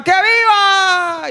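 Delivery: slow, loud, high cheer; drawn-out cheer. Retold or imitated. Preacher voicing everyone at the party, loud drawn-out cheer. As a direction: stretch out the cheer. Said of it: "y todos gritaban".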